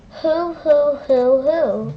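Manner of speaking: loud hooting owl. Pretend. Owl sound.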